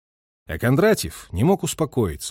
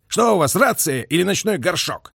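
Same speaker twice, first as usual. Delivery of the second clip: higher-pitched, mildly shouted jibe; commander's raised-voice scolding; shouted reproach; 7 semitones higher than usual. A narrator's reading. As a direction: berate the radio operator. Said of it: "крикнул Гуляев".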